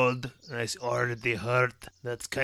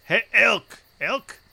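loud, higher call across the clearing; 9 semitones higher, 6 dB louder than usual. Acted. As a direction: hail the elk from afar. Calling out to an elk.

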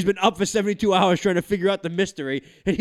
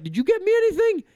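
put-on much higher little-kid voice; imitated child. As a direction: mimic a child's high, pointed question. Imitating his son confronting him.

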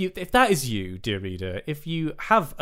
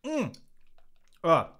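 sucking a lemon; 7 semitones higher than usual. Real, not acted. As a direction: mouth puckered from lemon. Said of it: "I was just sucking on a lemon".

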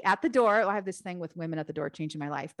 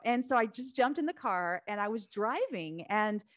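ordinary delivery

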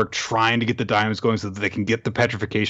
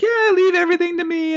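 mock Chelsea; 4 dB louder than usual. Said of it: "and she's like".

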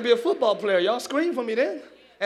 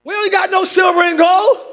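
loud high shout on gold; rising preacher cry; preached shout; 8 semitones higher, 10 dB louder than usual. Shown as a demonstration. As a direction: belt it to the congregation. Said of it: "I said".

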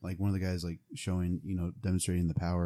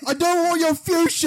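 very high, loud comic shriek; shrieking high shout; 8 dB louder than usual. Acted. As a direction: shriek it at the couch. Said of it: "Just be like".

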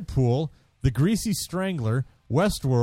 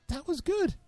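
much higher, pleasantly surprised; mock amazed verdict; 4 dB quieter than usual. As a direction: squeak it out, caught off guard. Quoting own surprise after movie.

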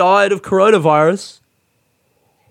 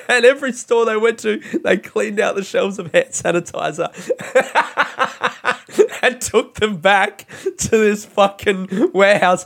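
higher voice breaking up with laughter; can barely get the story out for laughing; real laughter; 5 semitones higher than usual. Real, not acted. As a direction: laugh helplessly through the telling. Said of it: "this is why I'm laughing".

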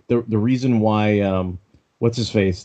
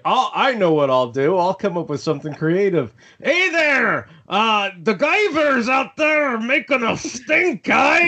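put-on Scottish accent; 14 semitones higher than usual. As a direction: ham up a silly Scottish accent. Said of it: "a Scottish accent".

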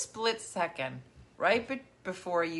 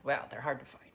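whispered reply; 6 semitones lower, 6 dB quieter than usual. Retold or imitated. Awkward reply to grandma.

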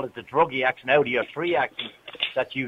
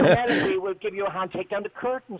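higher-pitched posh Dublin Four caricature; mock Dublin Four accent; 4 semitones higher than usual. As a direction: parody the fake upper-class accent. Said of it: "the Dublin four accent has to be a fake".

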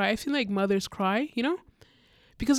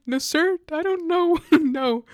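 flustered refusal; 7 semitones higher, 7 dB louder, slower than usual. Retold or imitated. Flustered refusing a man's money.

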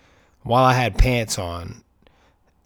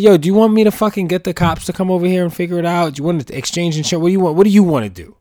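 mock confront; 6 semitones higher, 6 dB louder, faster than usual. Retold confrontation, bluffing.

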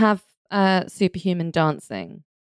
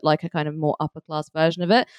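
normal talking voice

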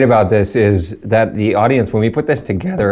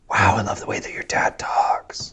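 whispered, softer mock awe; awed whisper; 6 dB quieter than usual. Host imitating friends who just met Dad, awed whisper.

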